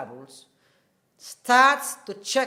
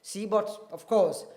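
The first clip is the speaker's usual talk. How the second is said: ordinary talk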